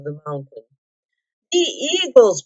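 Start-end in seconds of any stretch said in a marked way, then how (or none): 1.5-2.4 high eager call; excited cry. A narrator's reading. Bilbo calling to the army, excited cry. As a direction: cry it out once more. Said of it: "cried Bilbo once more".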